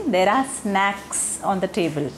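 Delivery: "snacks" with a clear open short a, said very slowly; slow careful model of "snacks"; correct short vowel in snacks. Shown as a demonstration. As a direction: draw out "snacks" with the open a of cat. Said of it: "क्या कहना चाह रही थी".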